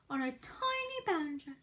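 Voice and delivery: ordinary delivery, nothing marked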